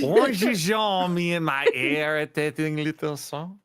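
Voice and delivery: mock French singsong; French accent. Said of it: "none of us can do a French accent".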